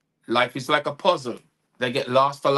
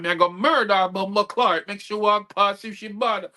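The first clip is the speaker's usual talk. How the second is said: louder, higher mock anger; talking mad; 6 semitones higher, 4 dB louder than usual. Wife threatening the helper, mock anger. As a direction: threaten it in a heated patois. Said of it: "she start talk like she mad".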